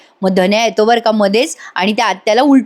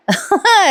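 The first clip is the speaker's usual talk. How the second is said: short laugh; 10 semitones higher than usual. Real, not acted. Narrator laughs at scene.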